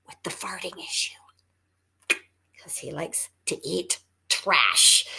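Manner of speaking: whispered aside. Real, not acted. Confiding aside about bulldog.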